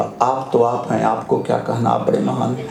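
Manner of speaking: bow out meekly, pitched well down; acted meek flattery to a donkey; humble mock deference. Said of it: "दोनों हाथ जोड़ के बोला".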